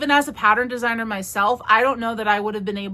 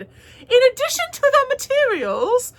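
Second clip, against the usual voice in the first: very high, exaggerated British lilt; mock British; 13 semitones higher, 4 dB louder than usual. Vlogger replaying her own slip, mock British and very high. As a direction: mimic an accidental squeaky British voice, hammed up. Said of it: "And I kinda sounded British".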